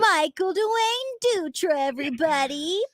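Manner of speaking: slow, high game-show announcement; announcer intro. Acted. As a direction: introduce him like a ring announcer. Announcing her dad.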